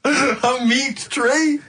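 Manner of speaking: high, slow, laughing "A meat tree?"; laughing disbelief. Real, not acted. Mocking the clue while laughing.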